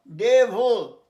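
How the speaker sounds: ডেভিল loud and clear, dev-il; correct pronunciation. Shown as a demonstration. Teacher loudly modelling a tricky word for the class. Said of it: "ভিল নয়".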